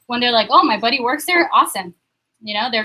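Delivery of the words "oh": ordinary delivery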